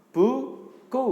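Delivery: high, loud model word for pupils to repeat; sounding out words. Shown as a demonstration. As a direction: call the word out slowly for the class. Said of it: "Sekali lagi". (mengeja kata dengan lantang)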